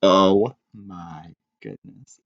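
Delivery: ordinary delivery, nothing marked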